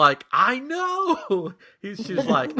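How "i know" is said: high, loud imitated mom; exasperated mom. Mom agreeing her kid is too young, high exasperated imitation. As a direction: agree emphatically, pitched way up.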